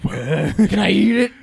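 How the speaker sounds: slow, loud dopey caricature; hammed-up monster voice with laughter; mock monster mom. Co-host riffing on the monster mom, mock monster voice. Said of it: "the mom monster's like".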